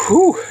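high sharp exhale from the burn; sharp whew. Real, not acted. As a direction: puff out the heat. Exhale after hot pepper bite.

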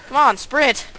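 ordinary delivery

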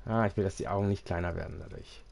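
ordinary delivery

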